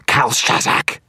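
high, loud rasping screech; strained shrieking incantation. A narrator's reading. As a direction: screech the final summons. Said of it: "his voice rising to a screech".

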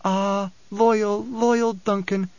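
high, slow, drawn-out fondness; wry Alia. A narrator's reading. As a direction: linger on the words in a high voice. Alia wryly answers Duncan.

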